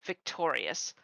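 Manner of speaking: ordinary delivery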